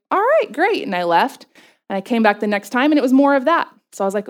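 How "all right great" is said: high, fake-cheerful agreement; mock chipper. To the therapist, faking cheer about tiny exercises, high-pitched. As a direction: sound politely unimpressed.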